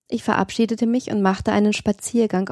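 ordinary delivery, nothing marked

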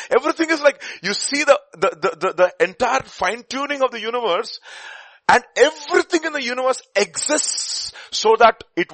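high, loud rush of excitement; excited. Real, not acted. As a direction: burst out with wonder. Excited outburst on fine tuning.

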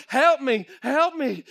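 high, loud begging; Billy begging the miners to help; desperate plea. Acted. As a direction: beg them for help.

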